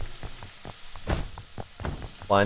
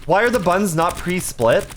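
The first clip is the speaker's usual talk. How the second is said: ordinary delivery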